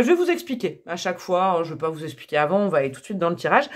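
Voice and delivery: ordinary talk, nothing marked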